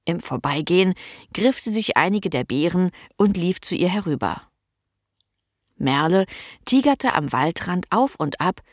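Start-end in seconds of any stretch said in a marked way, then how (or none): none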